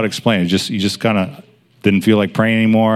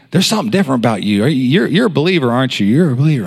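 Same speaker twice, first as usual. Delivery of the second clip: quick, higher-pitched eager guess; eager questioning; 4 semitones higher, faster than usual. Retold or imitated. Hostess discovering her coworker is a believer, eager. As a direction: blurt it out fast, pitch jumping. Hostess quoted in awkward excited approach.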